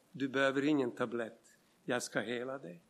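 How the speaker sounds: hushed, gentle voice of God; a quiet, calm voice speaking within; soft inner voice. Retold or imitated. God's voice to a sick man, hushed and calm. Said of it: "En röst säger".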